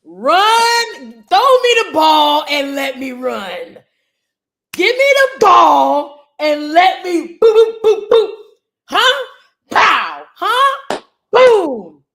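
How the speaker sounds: loud, slow hype shouts; yelling with playful bravado about getting the ball; hyped up. Pretend. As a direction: pump yourself up like a star running back. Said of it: "what position would you want to play?".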